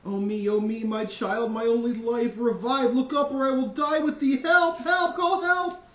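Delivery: high, loud frantic grief; wailing mother. Acted. Lady Capulet pleading over her dead child, loud and high. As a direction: beg her child to wake.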